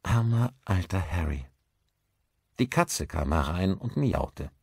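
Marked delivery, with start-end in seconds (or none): 0.0-1.4 understated wistful sigh of pity; gently rueful, mourning an old acquaintance. A narrator's reading. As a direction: say it with a touch of melancholy. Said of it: "Ihre Miene wurde wehmütig".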